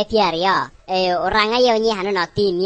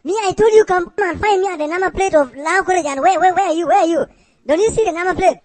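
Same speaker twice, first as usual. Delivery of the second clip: exasperated yelling at a lost worker; 8 semitones higher than usual. Acted. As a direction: shout the directions down the phone, higher. Boss shouting at worker over phone.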